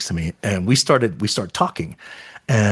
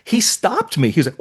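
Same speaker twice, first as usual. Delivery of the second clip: normal talking voice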